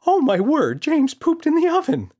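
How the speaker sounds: an appalled mother exclaiming; shocked gasp. Acted. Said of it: "She gasped and said".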